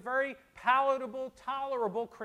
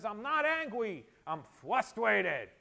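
imitating a small child, r's turning to w's; slow, protesting little-kid lisp; indignant four-year-old; slower than usual. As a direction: mimic a cross preschooler stretching out the words. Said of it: "when he was about four years old".

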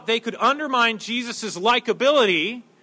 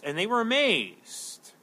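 ordinary delivery

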